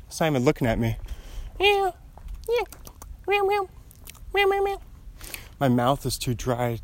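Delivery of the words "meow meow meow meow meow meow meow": very high little meows to a kitty; high sing-song cat talk; cooing at a cat. Real, not acted. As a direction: call the cat in a tiny high voice. Meowing at the cat.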